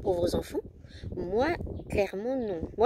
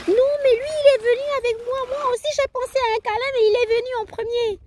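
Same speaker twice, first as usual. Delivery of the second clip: put-on higher, louder sulking small boy; voicing her son, pitched up and sulky; little boy voice; 12 semitones higher, 4 dB louder, faster than usual. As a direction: complain like a jealous four-year-old.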